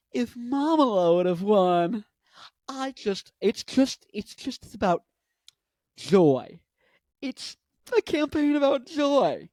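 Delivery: halting, high put-on voice, swelling on joy; exaggerated mimicry of Kamala Harris; Kamala impression. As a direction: stammer upward toward a big "joy". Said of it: "And so you got Mamala".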